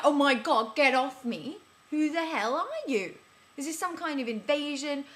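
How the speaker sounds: loud, high indignant outburst; outraged protest. Retold or imitated. Woman to stranger who kissed her, loud outrage. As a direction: push the man away with your voice.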